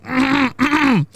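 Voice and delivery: high closed-mouth choking hums; mimed choking grunts. Retold or imitated. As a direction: grunt urgently as if unable to speak. Imitates friend unable to speak, choking.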